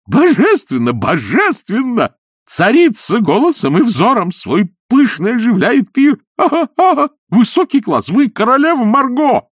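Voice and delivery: high, loud gushing flatterer; fawning high voice. Acted. Изнуренков fawning over the queen, high gushing voice. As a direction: gush over her like a fawning fool.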